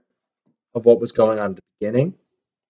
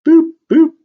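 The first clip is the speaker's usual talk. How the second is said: high, loud little boops; playful boop noises; 6 dB louder than usual. Pretend. Teacher dots in the pirate drawing, playful boops. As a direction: pop out two high-pitched boops. Sound effects while drawing the captain.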